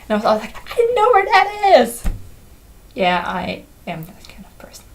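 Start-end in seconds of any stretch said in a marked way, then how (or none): 0.7-2.0 much higher and louder, thrilled; excited exclaim. Retold or imitated. Spotting her own city Vienna on TV, thrilled exclaim. 2.9-4.8 confiding whisper to the viewer; whispered aside. Real, not acted. Admitting to viewers she's that kind of person, whispered aside.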